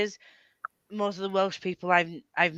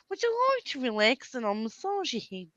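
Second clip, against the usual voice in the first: high, softer put-on presenter voice; Lloyd Grossman; 6 semitones higher, 5 dB quieter than usual. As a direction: glide through it in a mock accent. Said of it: "I used to like Lloyd Grossman".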